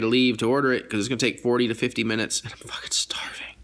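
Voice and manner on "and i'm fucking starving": hushed unvoiced aside; whispering. Real, not acted. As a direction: drop to a whisper. Hungry, muttered aside.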